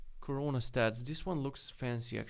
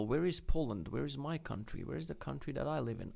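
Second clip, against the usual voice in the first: ordinary delivery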